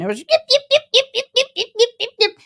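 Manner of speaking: high, sharp little yip yip yips; yappy dog imitation. Mimicking the faker's small dog, high yaps. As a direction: yap like a tiny annoying dog.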